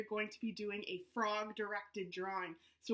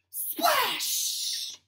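high loud burst; splash; 12 semitones higher, 8 dB louder than usual. Acted. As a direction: punch the splash. Story crash moment.

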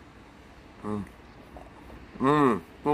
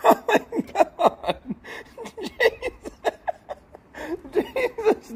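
laughing; slower than usual. Real, not acted. Still laughing hard.

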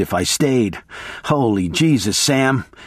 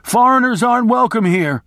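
shouted warning; 9 semitones higher than usual. Retold or imitated. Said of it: "I shouted".

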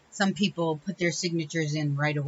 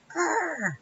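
frustrated growl; 13 semitones higher than usual. Retold or imitated. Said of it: "I was like".

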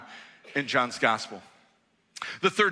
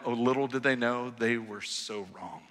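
normal talking voice

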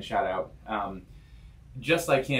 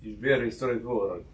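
put-on Russian accent. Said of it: "you do Russian accent".

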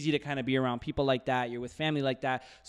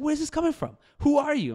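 much higher, alarmed questions; shocked parents; 14 semitones higher, 6 dB louder than usual. Retold or imitated. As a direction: play the stunned parents. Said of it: "they're just like".

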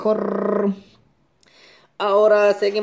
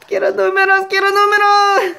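quiero números squeaked much higher and louder; mock pleading squeal; 12 semitones higher, 6 dB louder than usual. Begging the last ticket for numbers, high comic squeal.

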